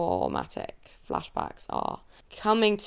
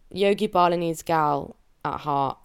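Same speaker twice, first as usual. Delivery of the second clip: ordinary delivery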